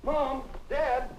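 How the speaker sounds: high-pitched excited announcement; excited calling out. Acted. Calling Mom and Dad about Linda's taxi, excited.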